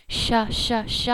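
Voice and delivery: sh letting the air rush out; breathy sh. Shown as a demonstration. Teacher showing the airy sh sound. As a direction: let the air stream out on sh. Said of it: "the air already comes out".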